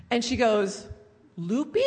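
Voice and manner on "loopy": higher, questioning mock mom; puzzled mishearing. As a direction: echo it as a baffled question. Mishearing mom asks in retold scene.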